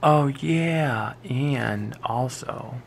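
ordinary delivery

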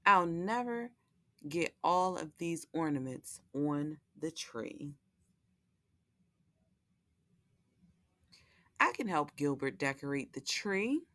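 lively picture-book read-aloud with character voices; reading to children. A narrator's reading. Host reading Lola the Elf to young viewers, storytelling voice. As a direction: read it like a bedtime story for little ones.

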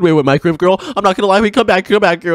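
normal talking voice

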